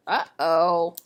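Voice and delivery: put-on a low, foreboding uh-oh to the children; ominous uh-oh. A narrator's reading. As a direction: drop low and dark, a knowing warning. Narrator's ominous aside.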